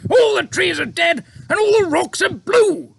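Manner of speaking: high, loud dismayed cry; cried. A narrator's reading. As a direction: Air Force chief crying out at the desert, dismayed. Air Force head voiced.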